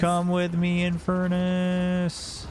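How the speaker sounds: slow, stretched heroic summons; drawn-out dramatic call. Pretend. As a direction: draw out the name like a game hero. Calling ally, drawn out.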